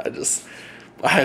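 normal talking voice

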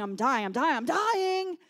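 loud, hammed-up cries of dying; imitating yelling. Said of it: "Derek starts yelling".

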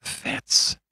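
a sudden scared whisper of the name; startled whisper. A narrator's reading. Doctor recognising his old friend, startled whisper. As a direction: breathe the name in shock. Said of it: "in a startled whisper".

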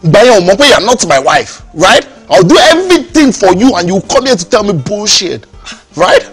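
loud, slow, cutting reproach; angry confrontation. Acted. Husband confronting wife at door.

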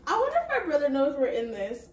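ordinary talk, nothing marked

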